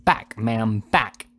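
angry order to back off; indignant husband, mildly heated; enraged husband. A narrator's reading. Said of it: "ebullition of rage".

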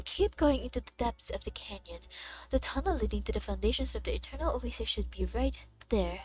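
soft, quiet game-dialogue read; quiet voiced-over dialogue read; reading game lines. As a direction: read the characters' lines softly.

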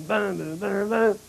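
ordinary talk, nothing marked

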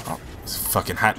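hushed, breathy whisper; whispered aside. Real, not acted. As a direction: whisper it to yourself. Unvoiced aside.

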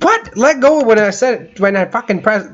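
high-pitched indignant protest; exasperated outburst. Real, not acted. Player scolds the game for ignoring his press, exasperated outburst. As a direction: burst out in frustration, then ease off.

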